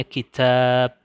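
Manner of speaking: "kitab" with a long, loud second syllable; stress on long vowel. Shown as a demonstration. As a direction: lean hard on the drawn-out "tab" in "kitab". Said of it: "harus ditekan, dinebrahkan". (tekanan panjang pada "tab" dalam "kitab")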